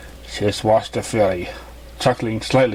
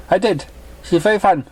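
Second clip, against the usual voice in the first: put-on a bit higher, shy young voice; lightly voiced pink pony; 8 semitones higher than usual. Pink pony politely answering the mother, lightly voiced.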